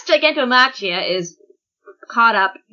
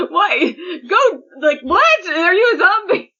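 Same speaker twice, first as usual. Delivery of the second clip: high-pitched comic disbelief; mock bafflement; 7 semitones higher than usual. Podcaster mimicking Dabi facing a supposed zombie, baffled. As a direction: imitate someone stunned and baffled.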